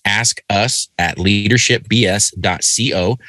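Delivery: slow, careful repeat. Shown as a demonstration. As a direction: spell the address out slowly and clearly. Repeating email address slowly.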